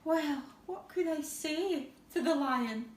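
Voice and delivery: usual speaking voice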